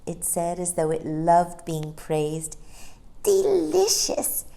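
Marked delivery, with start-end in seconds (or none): 3.2-4.5 put-on high, slow contented baby; happy sigh. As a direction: sigh it out blissfully. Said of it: "said Barbara with a happy sigh".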